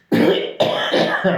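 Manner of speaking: loud raspy cough fit; harsh cough. Real, not acted. Sick perfume reviewer coughs on camera. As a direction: cough hard into the mic.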